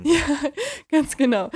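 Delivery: lacht beim Sprechen, langsam; lachend. Real, not acted. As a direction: lachend zustimmen. Lacht über Witz des Kollegen. (lachend, langsam und lauter)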